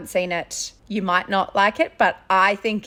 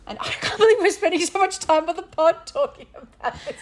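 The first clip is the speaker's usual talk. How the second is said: high-pitched, laughing disbelief; 7 semitones higher than usual. Real, not acted. Co-host amazed at the podcast's long tangent, laughing. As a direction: talk through real laughter.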